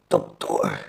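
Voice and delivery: strained, breathy rasp of a dying man; struggling to speak; with effort. Acted. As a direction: force the word out. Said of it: "disse ele com esforço".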